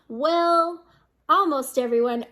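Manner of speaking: ordinary delivery, nothing marked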